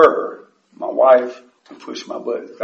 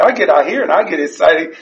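ordinary talk, nothing marked

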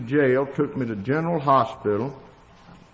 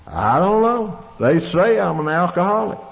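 retold exasperated reply; 5 semitones higher, 4 dB louder than usual. Retold reply to woman doctor.

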